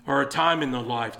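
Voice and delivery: usual speaking voice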